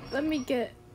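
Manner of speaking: ordinary talk, nothing marked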